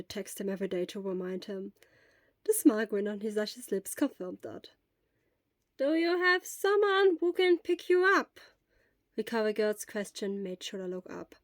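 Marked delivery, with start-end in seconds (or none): 5.8-8.4 lightly voiced elderly nurse. Elderly school nurse gently questions a feverish boy.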